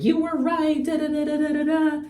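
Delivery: high, mock girly gushing. Mocking a gushing girlfriend, high girly voice. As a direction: imitate a friend gushing that he was right. Mocking a girl friend's reply.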